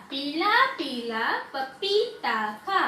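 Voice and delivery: slow word-by-word reading with long vowels. Shown as a demonstration. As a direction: read it out slowly for beginners. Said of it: "धीरे धीरे मात्राओं को उस पे लगाते हुए". (धीरे-धीरे, मात्रा खींचकर पढ़ना)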